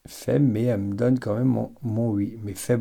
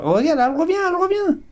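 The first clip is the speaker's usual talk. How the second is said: high excited squeal; thrilled it's coming back; faster than usual. Real, not acted. Rod swings back.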